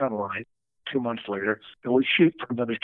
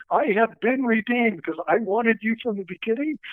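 much higher, gleeful retold crowing; triumphant producer; 9 semitones higher than usual. Producer telling Phil he was vindicated, high and gleeful. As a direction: crow it like you were proven right. Producer gloats about being right.

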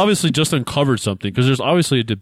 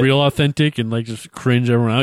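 ordinary delivery

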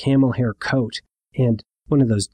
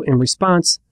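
ordinary talk

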